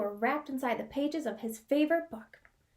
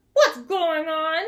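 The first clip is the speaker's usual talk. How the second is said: slow, louder grumbling question; Bear voice; 3 semitones higher, 9 dB louder, slower than usual. Acted. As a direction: rumble it out as Bear. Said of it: "said Bear".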